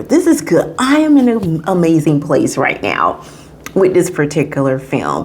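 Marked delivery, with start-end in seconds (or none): 0.0-0.7 said up high, savoring it; a delighted higher echo; pitched-up praise; high emphatic repeat. Real, not acted. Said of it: "repeats it higher".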